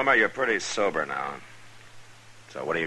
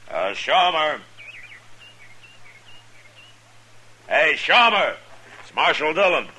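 loud call from the doorway, much higher; 11 semitones higher, 6 dB louder than usual. Acted. Marshal calling into the shack for the drunk, loud call. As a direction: call his name into the shack.

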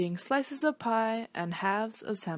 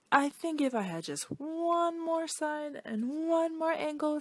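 much higher put-on wishful voice; hopeful shape voice; 7 semitones higher, slower than usual. Quadrilateral asks the shapeshifter for one more side, high and pleading.

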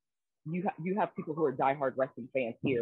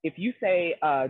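ordinary talk

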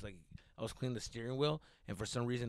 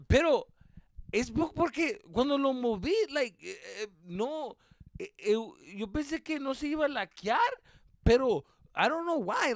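higher, louder stammering excuses; nervous excuses; 11 semitones higher, 7 dB louder than usual. Retold or imitated. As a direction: babble your way out of trouble. Pleading innocence to dad.

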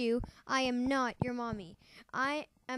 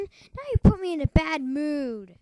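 ordinary delivery

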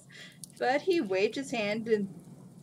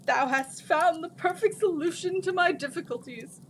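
higher, delighted genie; happy; 8 semitones higher, 4 dB louder than usual. A narrator's reading. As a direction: thank her with relief and joy. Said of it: "the Jin said happily".

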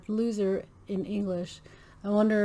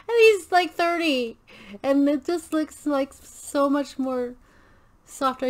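amazed disbelief; 5 semitones higher than usual. Real, not acted. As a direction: pitch it way up in awe. Amazed he looks thirty.

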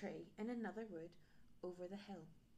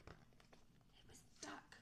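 slow whisper; whispered reveal; 6 semitones higher, 13 dB quieter, much slower than usual. A narrator's reading. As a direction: whisper the surprise.

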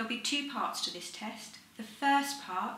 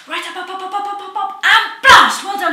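shouted much higher and louder; lung function test; 10 semitones higher, 22 dB louder than usual. Real, not acted. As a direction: drive them to blast the air out. Forced blast lung function test.

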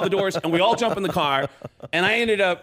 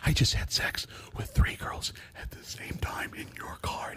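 hushed, unvoiced whisper; breath-only acted confession; confiding whisper; 11 dB quieter than usual. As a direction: whisper it like a guilty secret. Confessing to friend on stage.

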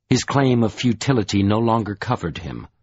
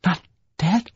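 breathless, higher rising question; panting disbelief; 9 semitones higher than usual. A narrator's reading. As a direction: gasp it out in disbelief. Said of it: "Troy panted".

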